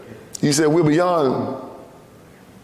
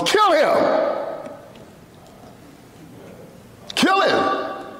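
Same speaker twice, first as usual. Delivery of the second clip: much higher, ringing command; Pharaoh's order; 10 semitones higher, 3 dB quieter, much slower than usual. Retold or imitated. Preacher voicing Pharaoh ordering boys killed, high command. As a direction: bark it like a cruel king. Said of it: "What did Pharaoh say".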